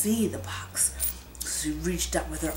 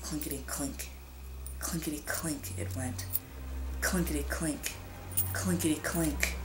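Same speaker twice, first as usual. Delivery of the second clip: whispered clinking; 7 dB quieter than usual. A narrator's reading. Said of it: "silver dollars rattling".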